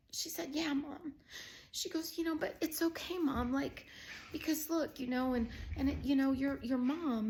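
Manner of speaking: high, choked-up retelling; tearful. Real, not acted. Recounting Kristen gently answering mom, tearful. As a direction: struggle through it, close to tears.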